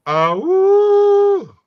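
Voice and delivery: long, high, loud drawn-out oh; big drawn-out shocked oh; surprised exclamation. Real, not acted. Reacts to a bold pick.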